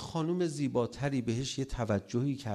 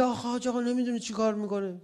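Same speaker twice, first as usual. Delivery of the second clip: high, quick mock grumbling; imitated irritated complaint; 11 semitones higher, 3 dB louder, faster than usual. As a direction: act out a fed-up spouse complaining to the cleric. (ادای شکایت عصبی با صدای زیر و تند)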